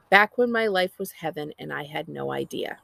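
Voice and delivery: ordinary delivery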